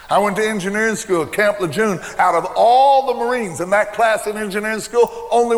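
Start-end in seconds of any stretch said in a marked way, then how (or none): none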